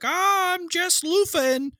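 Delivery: very high, slow, innocent voice; coy wife. Retold or imitated. Wife caught in the shower, very high and coyly innocent. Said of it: "she's like".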